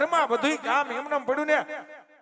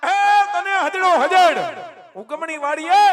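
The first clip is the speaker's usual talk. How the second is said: loud, raised scolding; angry scolding; 2 semitones higher, 6 dB louder than usual. Acted. Uncle berating his nephew over where he was, angrily. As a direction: scold him loudly, pitch up.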